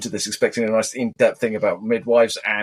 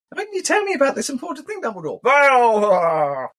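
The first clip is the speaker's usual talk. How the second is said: high, drawn-out mock wail with a cry in it; whiny Harry; 12 semitones higher, slower than usual. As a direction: stretch the name into a hammed-up sobbing plea. Mocking Harry's complaint to Dumbledore.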